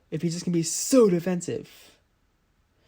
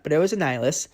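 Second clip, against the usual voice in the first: ordinary talk, nothing marked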